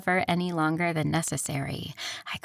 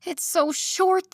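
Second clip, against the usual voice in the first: slow whiny complaint, pitched up; complaining; 12 semitones higher, 4 dB louder, slower than usual. Acted. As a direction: whine about the dress. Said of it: "I complained".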